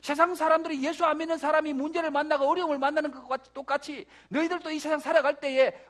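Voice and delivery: loud, high, driving pulpit delivery; full-force preaching. Real, not acted. Preacher warning believers they too will meet trouble, full force. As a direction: push each clause out forcefully.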